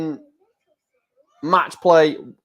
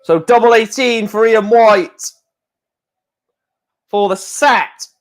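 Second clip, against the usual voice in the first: high, excited play-by-play call; live darts commentary; 6 semitones higher than usual. Real, not acted. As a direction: call the winning darts with raised pitch.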